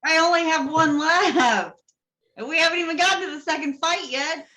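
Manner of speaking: worked-up, high-voiced objection; high-pitched protest. Real, not acted. Player protests over last hero point.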